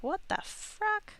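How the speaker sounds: high, slow, baffled squeal; mock confusion. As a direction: act shocked at your own drawing. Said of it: "it's like".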